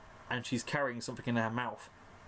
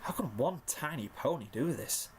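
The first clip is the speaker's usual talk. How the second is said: usual speaking voice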